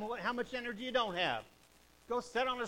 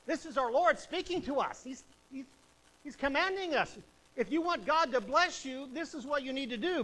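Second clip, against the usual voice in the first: raised, fervent preacher voice; full-force preaching; 6 semitones higher, 6 dB louder than usual. Acted. Preacher urging the church to obey, at full force. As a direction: preach it to the congregation.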